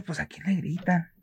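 softly, playing dumb; pretending not to hear, softly; soft mock puzzled voice. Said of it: "Y yo decía". (fingiendo no oír, en voz baja)

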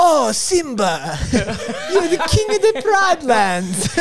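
high, slow mock African accent; hammed-up Lion King elder in an African accent; Pride Lands accent. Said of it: "do your Pride Lands accent".

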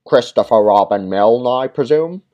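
a stout officer sizing him up; stout man. Acted. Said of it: "the stout man inquired".

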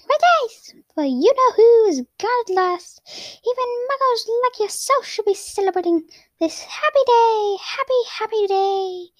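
put-on high squeaky little old man; bubbling over with joy, high and squeaky. A narrator's reading. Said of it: "said in a squeaky voice".

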